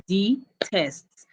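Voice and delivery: "detests" broken into two beats, de, tests; syllable by syllable. Shown as a demonstration. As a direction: pause between de and tests in "detests". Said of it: "you tap your hands".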